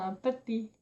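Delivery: savoring "un pâté" in a soft playful voice; amused repeat. Shown as a demonstration. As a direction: repeat "un pâté" quietly, enjoying the word. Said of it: "j'aime bien comment je parle".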